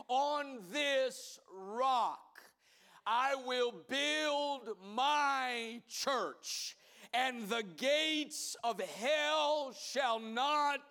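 very slow, weighty proclamation; solemn pronouncement. Acted. Jesus promising Peter the church, very slow and solemn. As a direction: lay each word down slowly like stone.

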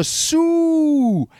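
high, drawn-out celebration call; celebration cry. Shown as a demonstration. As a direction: stretch it into a long rising-pitched whoop. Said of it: "has an iconic celebration".

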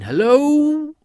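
long, very high, loud holler; drawn-out shout soaring upward; calling out. A narrator's reading. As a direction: stretch the hello into a big echoing call. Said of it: "He called out again".

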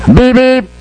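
high, bright beep beep like a car horn; playful high car horn imitation; mock horn beep.

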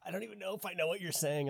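high, quick quoted protest; imitated confusion. Acts out confused French friend.

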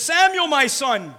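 loud, high, drawn-out call. Acted. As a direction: call out slowly and loudly to someone. Eli calls out to Samuel.

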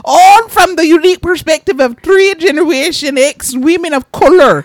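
high, loud mock-British hostess; Missus Doubtfire British accent. Host introduces the show in hammed-up Missus Doubtfire British accent. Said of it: "that was me doing a, like, a Missus Doubtfire".

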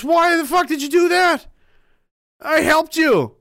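a stung frog yelling at the scorpion; outraged frog. Acted. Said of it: "And the frog goes".

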